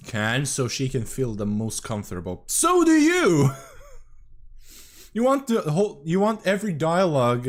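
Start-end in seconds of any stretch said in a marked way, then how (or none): 2.5-3.5 high, loud outburst; loud accusing outburst. Real, not acted. Calling out the streamer. 5.1-7.5 loud accusation. Real, not acted. Calling out the streamer.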